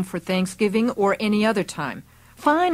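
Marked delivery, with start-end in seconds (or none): 2.3-2.7 high, sharp defiant retort. A narrator's reading. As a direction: snap it back, high and loud. Daughter retorts to raging mother.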